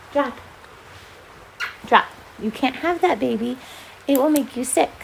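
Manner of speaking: quiet, gentle coaxing of a dog; softly talking to the pet. Real, not acted. Soft talk to the dog.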